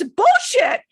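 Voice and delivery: high, loud mock outburst; imitated loud, indignant heckle; Cosmo. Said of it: "Even Cosmo goes".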